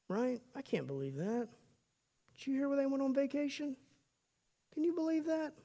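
a gossip sneering at the neighbours' new purchases; catty gossip. Acted. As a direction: whisper it like judging neighbours behind their backs. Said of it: "what's behind that is".

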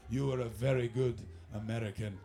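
low-pitched mock-Russian voice; comic deep Russian accent; imitated Russian accent. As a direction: deliver solemn praise in a Russian accent. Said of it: "hung out with Russians".

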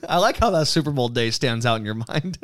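usual speaking voice

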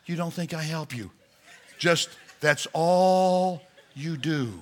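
normal talking voice

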